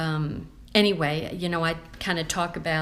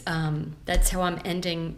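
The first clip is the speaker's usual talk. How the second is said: ordinary talk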